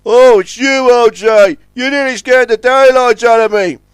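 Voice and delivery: high, loud, rattled outburst; Scuttlebutt crying out. A narrator's reading. Scuttlebutt recognises O J after a fright, crying out. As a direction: exclaim after a bad scare. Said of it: "cried Scuttlebutt".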